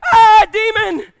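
high, very loud hammed-up cry; mock alarmed yell. Someone blaming a stubbed toe on demons, mock yell. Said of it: "and I go".

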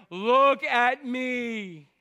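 long, drawn-out attention-seeking call; mock showing off. Preacher mimicking Pharisees who pray to be seen, mock showing off. Voices show-off motives of Pharisees.

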